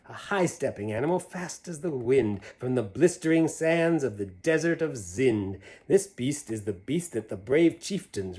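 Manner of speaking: put-on lively read-aloud to kids; animated picture-book reading; story narration. A narrator's reading. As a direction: read the rhyme with a bouncy lilt. Reads Seuss poem to children.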